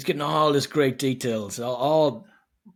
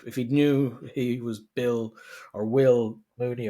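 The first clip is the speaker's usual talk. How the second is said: normal talking voice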